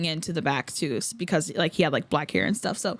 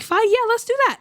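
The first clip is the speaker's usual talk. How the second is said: his casual yes pitched higher; a quick, easy yes, voiced higher; voicing the idol; 13 semitones higher, 4 dB louder, faster than usual. Fan voices the idol agreeing to the pose, casual higher yes.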